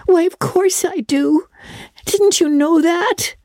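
put-on very high little-girl voice, emphatic; Diana, devoted girl. A narrator's reading. Diana assures Anne of her love, earnest high voice. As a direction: pitch it way up, earnest child.

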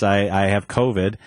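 usual speaking voice

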